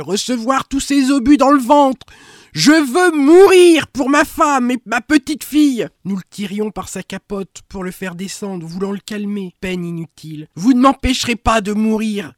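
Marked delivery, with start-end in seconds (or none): 0.0-5.9 loud, high, desperate cry to die. Acted. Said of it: "cria-t-il". 10.6-12.3 loud, higher howl of despair. Acted. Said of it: "hurlait-il".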